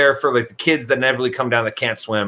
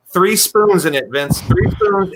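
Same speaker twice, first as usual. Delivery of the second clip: higher, louder stress on spoons; incredulous emphasis; 6 semitones higher, 4 dB louder, slower than usual. Real, not acted. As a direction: hammer home the absurd detail. Disbelief at gross discovery.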